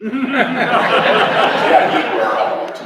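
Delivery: high loud laughter; laughing at losing the question. Real, not acted. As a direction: laugh then carry on. Laughing at own ramble.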